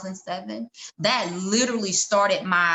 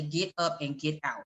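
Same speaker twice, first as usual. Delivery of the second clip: low, slow, soft command; hushed, lowered voice of God; bass voice; 4 semitones lower, 7 dB quieter than usual. Retold or imitated. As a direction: imitate God's deep voice, slowly and quietly. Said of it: "it was a bass in the".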